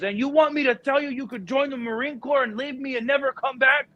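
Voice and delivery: high-pitched mock rant; hammed-up betrayed father; dad going crazy. Dad ranting at son in school, hammed-up anger. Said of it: "He goes".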